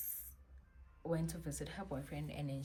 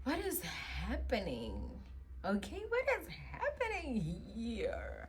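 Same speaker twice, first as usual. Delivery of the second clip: bewildered; 6 semitones higher than usual. Retold or imitated. Reacting to his strange calls, baffled and higher. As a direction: act utterly confused. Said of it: "I'm just like".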